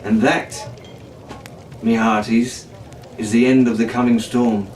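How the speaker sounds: low, easy storyteller's farewell; pirate storyteller sign-off. A narrator's reading. Storyteller bids the hearties farewell, low and easy. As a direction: wrap the tale for the listeners.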